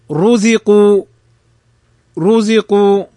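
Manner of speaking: رزقوا with a rounded, thick r; heavy ra. Shown as a demonstration. Teacher models رزقوا for students, heavy ra. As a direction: say رزقوا firmly, r made heavy. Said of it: "فالراء مضمومة فتفخم".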